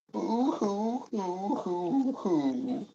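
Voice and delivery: high, slow fake sobbing boo hoo; drawn-out pretend boo hoo hoo; mock boo hoo. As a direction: ham up a babyish sob. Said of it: "making me sad".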